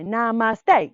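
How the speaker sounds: loud called namaste; sergeant call. Shown as a demonstration. Teacher as sergeant calling to little yogis. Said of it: "I am the sergeant".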